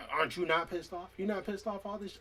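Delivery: usual speaking voice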